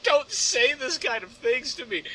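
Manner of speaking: high, slow melodrama; mock heartbroken plea, pitched high and slow; dramatic. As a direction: play the wounded lover, hammed up. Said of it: "I just got all dramatic about it".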